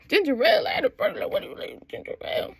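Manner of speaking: hushed, voiceless jabbering imitation; mocking bartender. Bartender scoffing at a ginger ale order, mocking. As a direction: gabble it under the breath in mockery.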